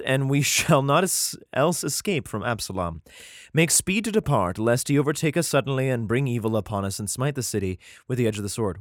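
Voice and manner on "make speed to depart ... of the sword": ordinary talk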